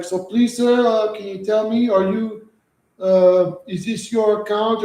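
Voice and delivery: high, pleading put-on caller voice; scam caller voice. Streamer mimics scam caller pleading on phone, high put-on voice. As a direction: mimic the scammer begging you to listen.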